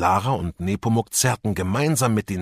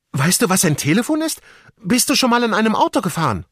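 eager questions; 6 dB louder, faster than usual. A narrator's reading. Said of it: "fragte Lara".

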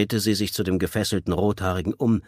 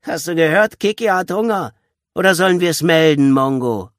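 high, loud goading; bullying boy's loud taunt; 10 semitones higher, 9 dB louder than usual. A narrator's reading. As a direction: jeer at a hesitating child. Boy goads and threatens child.

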